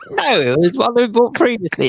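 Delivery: ordinary talk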